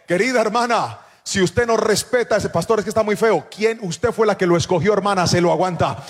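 loud, driving pulpit delivery; full-force preaching. Real, not acted. Preacher warning the church to respect God's limits, preaching at full force. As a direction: preach it hard to the congregation.

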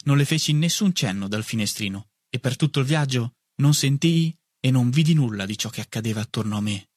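ordinary delivery

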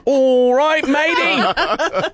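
put-on Australian accent, laughing; hammy fake Aussie accent. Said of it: "using my Australian accent".

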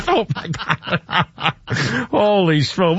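slow, laughing through it; host laughing at the caller's story. Real, not acted. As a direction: crack up at the punchline.

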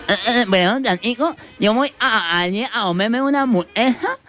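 very high voice, consonants swallowed; cleft-lip boy caricature. Acted. Boy off to eat a hamburger, high cleft-lip voice. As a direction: stammer it high with mangled consonants. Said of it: "un boquinchito que iba a".

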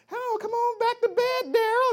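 high-pitched mock girlfriend voice; exaggerated high girlfriend impression. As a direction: coax him in a put-on high female voice. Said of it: "this girl's like".

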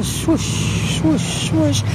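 slow, drawn-out whooshing sound; imitated wind swoosh. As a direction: mimic the eerie rush of wind. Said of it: "you could hear like a".

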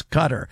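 Qatar as "CUT-er"; "Qatar" with a weak second syllable; stress on first syllable. Shown as a demonstration. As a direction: say Qatar like the word cutter. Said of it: "the proper is Qatar".

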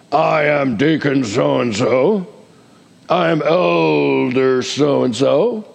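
slow, stiff, self-important mock title; a hint of a puffed-up church elder; mock pompous. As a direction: announce yourself grandly and slowly. Mocking people who use titles.

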